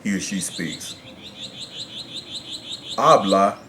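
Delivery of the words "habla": "Habla" stressed on the first A; stress on first syllable. Shown as a demonstration. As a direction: lean on the first syllable of "Habla", then drop off. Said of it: "natural stress is on that first syllable".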